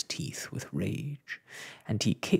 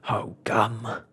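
lightly gasped exclamation; out-of-breath character voice; gasping. A narrator's reading. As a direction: gasp it out like a winded lizard. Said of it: "gasped Mister Lizard".

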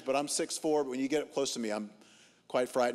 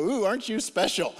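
mock-impressed, higher sarcastic jab; 6 semitones higher, 4 dB louder than usual. Preacher answering the entitled passenger, higher sarcastic mock awe. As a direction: tease him as if he's precious. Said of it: "I go".

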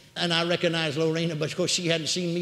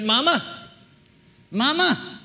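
high, raised call to an unconscious mother; calling to rouse; 8 semitones higher, 5 dB louder, slower than usual. Retold or imitated. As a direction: call out to wake her. Daughter calls to comatose mother.

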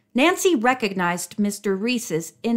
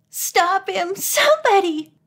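very high cry for help; frightened plea; 10 semitones higher than usual. A narrator's reading. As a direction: plead in alarm for someone to step in. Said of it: "Bess trembled".

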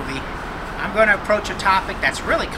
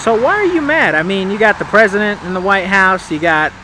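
insistent questioning; 4 semitones higher, 8 dB louder than usual. Acted. Interviewer presses him on his anger.

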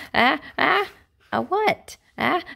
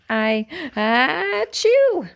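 acted sneeze; 3 semitones higher, 4 dB louder, slower than usual. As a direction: sneeze cuts the sentence off, hammed-up. Gerald sneezes mid-sentence.

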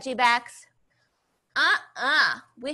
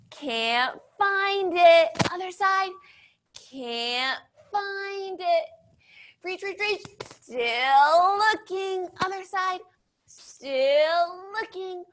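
slow searching; 6 semitones higher, slower than usual. Pretend. Pretend search for soap.

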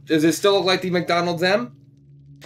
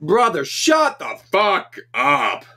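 slow, louder, each word stretched; drawn-out exasperated telling-off; 4 semitones higher, 4 dB louder, much slower than usual. Real, not acted. Vlogger tells his brother to shut up, slow exasperated. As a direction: snap at the noisy brother, fed up.

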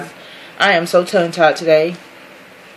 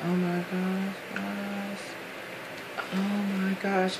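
soft, breathless oh my gosh; overwhelmed, almost under her breath; awed hush; 12 dB quieter, slower than usual. Real, not acted. Unboxing a gift box, she murmurs in quiet awe. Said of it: "I am so in awe of this box".

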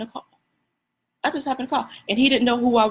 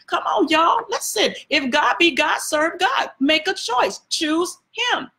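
high, urgent pulpit call to choose God; climbing fervent preacher cry; preaching at full force; 6 semitones higher, slower than usual. Acted. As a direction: lift it into a rousing altar call. Rising preacher's exhortation.

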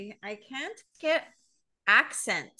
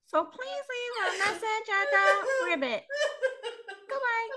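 very high puppet frog sign-off; high frog voicemail voice; Kermit the Frog; 12 semitones higher than usual. Acted. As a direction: sign off as a squeaky cartoon frog. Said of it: "all in a frog voice".

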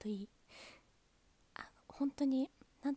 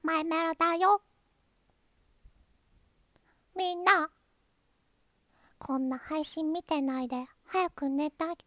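higher, louder cute cartoon voice; My Melody impression; 4 semitones higher, 6 dB louder than usual. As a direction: play the sleepy cute mascot telling fans to go to bed. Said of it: "似てる？".

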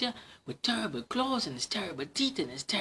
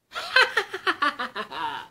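high gleeful giggle; a little triumphant laugh; mouse giggle; 10 semitones higher, 7 dB louder than usual. Acted. Mouse laughs at the fleeing fox.

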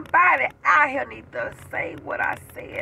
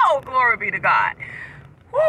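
usual speaking voice

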